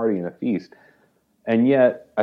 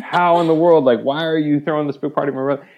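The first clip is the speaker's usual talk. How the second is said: voiced older brother, louder, higher and fast in mock outrage; indignant outburst; 7 semitones higher, 5 dB louder than usual. Older brother protesting the party to father, mock indignant. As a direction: blurt it like the resentful son who can't believe it. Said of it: "He's angry".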